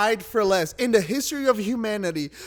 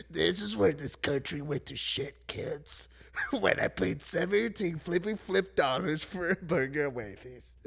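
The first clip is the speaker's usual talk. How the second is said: a lightly voiced old dad, soft and hushed, to his kids; mock-grave old-timer lecturing the kids quietly; old dad telling kids; 3 semitones lower, 7 dB quieter than usual. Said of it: "I would tell my kids".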